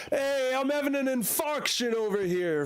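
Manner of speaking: high, brash mock New York accent. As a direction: ham up a New Yorker yelling over here. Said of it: "Why does every New Yorker speak like that?".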